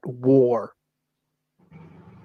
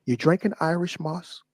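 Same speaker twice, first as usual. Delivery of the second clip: normal talking voice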